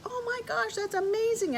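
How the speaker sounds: high, gushing oh my gosh; excited gushing. Retold or imitated. Friends gushing over the heart rock, high and excited. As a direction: squeal it like amazed friends. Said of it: "They're like".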